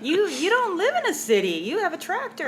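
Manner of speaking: high, laughing mock astonishment; retold amazement. Woman retelling her reaction to a farmer's tractor photos, high and amazed. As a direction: relive the giddy disbelief, pitch climbing.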